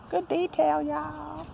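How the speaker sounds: high sing-song. Pretend.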